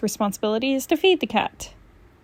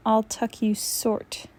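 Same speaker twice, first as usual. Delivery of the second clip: ordinary delivery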